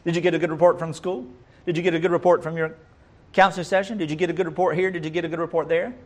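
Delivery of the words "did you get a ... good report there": ordinary talk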